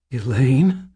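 high, rising, alarmed call; high startled query; shocked question. A narrator's reading. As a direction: call the name in frightened disbelief. Shocked boy calls to Elaine.

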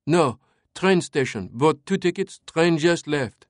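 put-on high-pitched, French-accented report; eager lieutenant. A narrator's reading. Lieutenant updating his captain on the fugitives, eager.

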